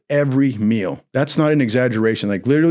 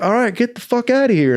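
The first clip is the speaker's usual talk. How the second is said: higher, hard-called summons; barking chief; 10 semitones higher than usual. Retold or imitated. Navy chief calling the recruit into his office, barking. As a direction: call him in like a gruff chief. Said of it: "he's like".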